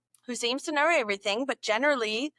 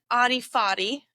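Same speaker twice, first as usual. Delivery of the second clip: normal talking voice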